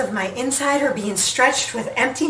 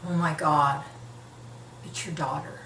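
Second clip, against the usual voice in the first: stunned whisper; 9 semitones lower, 10 dB quieter than usual. Retold or imitated. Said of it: "I hear him gasp".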